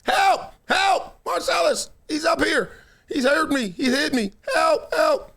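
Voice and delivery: mock high shriek for help; high desperate cry of help, hammed up; woman screaming for help. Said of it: "out the window is a lady screaming".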